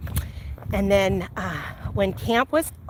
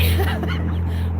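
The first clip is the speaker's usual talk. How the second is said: light laugh; 10 semitones higher than usual. Real, not acted. Laughs at end of love story.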